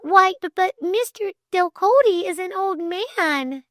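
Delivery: high, stammering disbelief; high-pitched flustered protest; shocked gasping. A narrator's reading. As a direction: gasp and falter in astonishment. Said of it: "she gasped".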